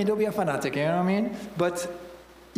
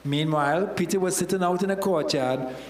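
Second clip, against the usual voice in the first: ordinary talk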